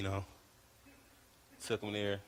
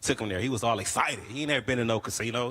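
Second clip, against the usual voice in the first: ordinary delivery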